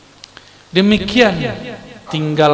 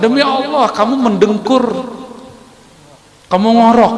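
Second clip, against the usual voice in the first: loud, high accusing oath; hammed-up loud accusation; swearing insistence; 7 semitones higher, 4 dB louder than usual. Friend swears he snored.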